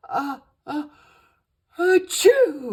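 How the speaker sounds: high, loud build-up into a sneeze. A narrator's reading. Genie sneezing in the cold castle. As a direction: sneeze it out big. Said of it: "Rocky sneezed".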